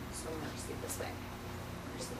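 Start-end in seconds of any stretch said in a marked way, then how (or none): none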